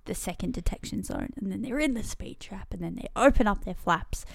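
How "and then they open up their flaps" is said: usual speaking voice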